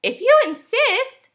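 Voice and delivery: very high, obliging bird voice; lilting storybook bird; sparrow. A narrator's reading. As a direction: accept the challenge in a tiny high voice. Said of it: "said the sparrow".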